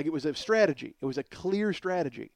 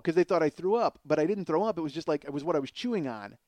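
ordinary talk, nothing marked

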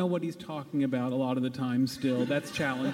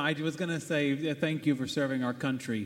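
normal talking voice

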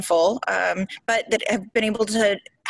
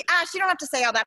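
higher-pitched imitation of a director waving it off; dismissive director; 7 semitones higher, faster than usual. Director cutting an actress's monologue, lightly mocked dismissal.

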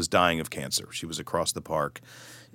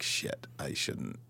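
slow, much softer whisper to yourself; slow hushed whisper of regret; whispered aside; 7 semitones lower, 12 dB quieter, slower than usual. Retold or imitated. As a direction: mutter it under your breath in dismay. Private panicked aside.

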